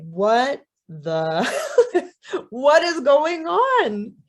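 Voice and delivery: slow, baffled outcry rising high and loud; stunned disbelief. Acted. Woman to the talking crow, loud laughing disbelief. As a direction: break off with a laugh, then exclaim.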